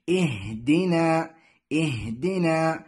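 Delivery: "اهدنا" slowly recited as ihdinaa, starting with i; kasra on hamza. Shown as a demonstration. Teacher shows learners the first vowel, "اهدنا" as ihdinaa. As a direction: start "اهدنا" on a clear i, drawn out. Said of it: "همزة الوصل هنا مكسورة".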